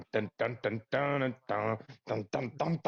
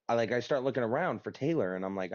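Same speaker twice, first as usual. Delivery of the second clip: usual speaking voice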